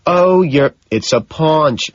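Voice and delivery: a loud, pressing correction; stressed insistence. Acted. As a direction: insist hard on paunch. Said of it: "I stress".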